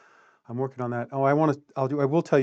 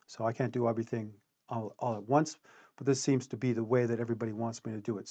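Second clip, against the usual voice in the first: usual speaking voice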